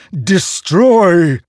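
high loud commanding sword voice; ringing metallic sword voice. Acted. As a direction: bark it like a hungry blade. The sword demands.

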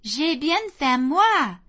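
high, loud, drawn-out French; eager little girl's plea, slow and high; excited child adding. A narrator's reading. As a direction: stretch it out loudly, ending very high.

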